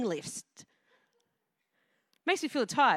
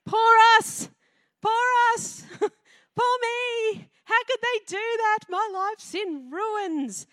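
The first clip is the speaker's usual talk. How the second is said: high, loud mock whining; hammed-up self-pity, pitched up; whiny pity party voice; 8 semitones higher, 3 dB louder, slower than usual. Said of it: "It's had the giant pity party".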